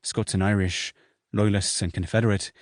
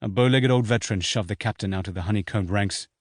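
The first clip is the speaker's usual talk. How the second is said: usual speaking voice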